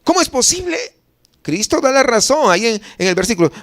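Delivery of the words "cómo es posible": incredulous. Acted. Acts bafflement at the refusal.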